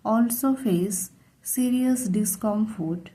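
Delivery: ordinary talk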